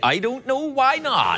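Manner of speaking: high, drawn-out West Country reply; west coast of England accent. Pretend. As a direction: swoop up in a put-on West of England lilt. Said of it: "strange west coast of England accent".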